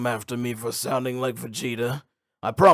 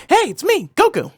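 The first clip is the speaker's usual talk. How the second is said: much higher, cheerful Goku greeting; light Goku voice; 7 dB louder than usual. Retold or imitated. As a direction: chirp it like young Goku. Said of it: "his light voice".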